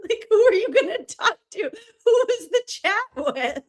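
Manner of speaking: high, laughing question. Real, not acted. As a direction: ask through real laughter. Laughing while teasing friend.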